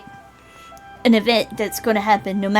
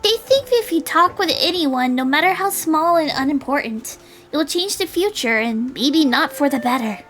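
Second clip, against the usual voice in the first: put-on high girlish character voice; high, animated young character; explaining girl; 6 semitones higher than usual. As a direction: explain it earnestly in a higher voice. Voices Julie explaining the theory.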